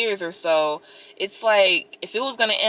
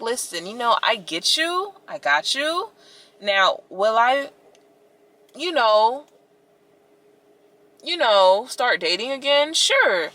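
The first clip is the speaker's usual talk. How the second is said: playful reply; 6 semitones higher than usual. Retold or imitated. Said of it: "And I'm like".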